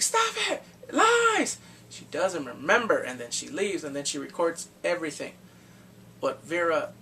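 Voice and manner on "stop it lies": very high, loud scream of denial. Retold or imitated. Daughter refusing her mother's words, screamed. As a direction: shriek it up high to block it out.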